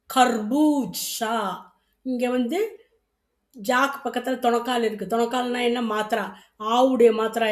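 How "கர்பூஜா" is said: "கர்பூஜா" drawn out very slowly, syllable by syllable; slow careful model. Shown as a demonstration. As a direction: say "கர்பூஜா" slowly and clearly for students. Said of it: "அகா". ("கர்பூஜா" மிக மெதுவாக தெளிவாக)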